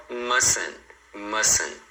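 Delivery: mustn't with both Ts dropped; silent T. Shown as a demonstration. Teacher models the word for learners, silent T in mustn't. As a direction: say mustn't without any T sound. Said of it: "Não fala T nenhum".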